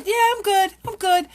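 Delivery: high, doubtful, half-questioning; unconvinced I'm good. Retold or imitated. People answering a greeting, unconvinced and questioning. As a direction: say it like you don't believe it yourself. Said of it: "they're, like, even questioning it".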